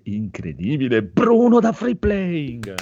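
high, loud announcer shout with a drawn-out name; hyped guest introduction. Real, not acted. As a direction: build to a big ring-announcer yell.

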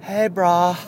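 high-pitched San Diego bro greeting; San Diego accent. Acted. Mocking people who say brah, San Diego accent. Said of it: "they say brah".

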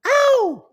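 squeaky high Ow yelp; tiny cartoon squeal of Ow; falsetto ow. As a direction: shriek Ow in a high put-on voice. Falsetto yelp after sting.

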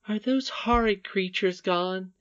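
high, slow little-princess voice; anxious princess. Acted. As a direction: ask timidly in a high child's voice. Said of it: "remembering first what had terrified her".

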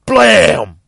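loud, high, drawn-out bang; shouted gunshot. Retold or imitated. Storyteller imitating the junkie's gun firing, explosive shout. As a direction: blast out the gun noise, stretched long.

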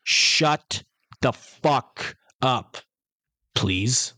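slow, emphatic retold rebuke with a dropped-down please; retold telling-off, mock-polite ending. Man answering a cheeky girl in the cinema, slow and forceful. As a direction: space the words out, then tack on the courtesy. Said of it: "I look at her and I go".

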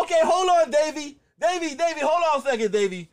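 very high, loud grandma voice; hammed-up grandmother on the phone; shrieky imitation of a friend's grandma. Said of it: "She was like".

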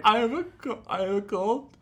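high, whiny fake weeping; mock crying. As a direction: blubber pitifully as a joke. Mimicking man crying over a cold.

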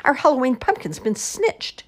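lightly voiced old woman, high; high-pitched storybook outcry; crying out, ancient woman. A narrator's reading. Old woman announces the pumpkin theft, high mild outcry. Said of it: "cried in a seven hundred-year-old voice".

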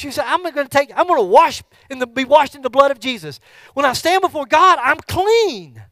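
loud, high preaching; rally the congregation; fervent sermon build; preaching at full force. Acted.

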